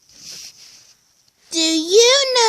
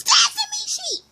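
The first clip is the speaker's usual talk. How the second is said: squeaky voice. Pretend. Squeaky joke voice.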